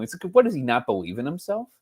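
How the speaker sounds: ordinary talk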